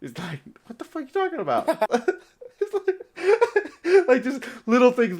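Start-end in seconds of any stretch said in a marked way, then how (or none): 0.7-1.7 much higher, fast incredulous outburst; fast high-pitched disbelief; baffled outburst. Acted. Cap baffled by five-hour flight. 1.7-5.2 high laughing talk; words squeezed out through laughter; giggling while talking. Real, not acted. Laughing after the joke.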